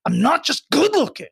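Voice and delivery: mock an actor pleading to be taken seriously; frustrated protest. Imagined handsome actor to the viewer, mock frustrated protest. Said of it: "saying really frustrated".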